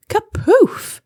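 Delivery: high, loud kapoof; bouncy cartoon crash; sound-effect burst. A narrator's reading. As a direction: pop the word like a landing thud. Sound word in rhyming story.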